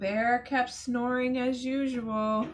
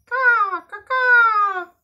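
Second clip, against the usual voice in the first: loud high cawing like crows; crow caws; 12 semitones higher, 16 dB louder, slower than usual. Acted. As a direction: caw like a flock of crows. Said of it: "The crows caw".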